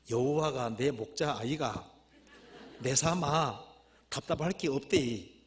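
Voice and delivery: punchy reading in Gyeongsang dialect. Acted. Preacher reading the Gyeongsang version of Psalm 23, Gyeongsang dialect. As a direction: put on a Gyeongsang accent for the verse. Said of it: "경상도 버전".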